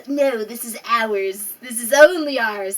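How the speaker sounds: put-on slow, loud grumpy old-timer; a possessive old person clinging on. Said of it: "I sound like an old person".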